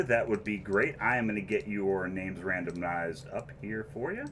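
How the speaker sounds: usual speaking voice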